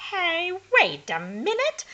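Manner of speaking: high, loud sudden objection; crying out. A narrator's reading. Hermione squints at the dirty face, high loud cry. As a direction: stop them short, surprised. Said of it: "cried Hermione".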